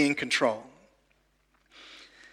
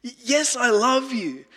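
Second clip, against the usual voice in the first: higher, earnest pleading voice; passionate Peter; 8 semitones higher than usual. Retold or imitated. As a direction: plead as a devoted friend.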